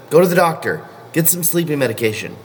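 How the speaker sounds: ordinary talk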